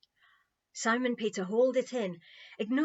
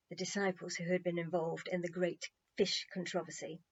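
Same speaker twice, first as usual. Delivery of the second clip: ordinary delivery, nothing marked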